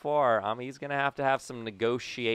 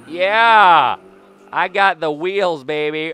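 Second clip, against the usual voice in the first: loud, high victory yell; triumphant shout; 7 semitones higher, 14 dB louder, slower than usual. Real, not acted. Player celebrating a stolen base, triumphant shouting. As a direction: crow it at the top of your voice.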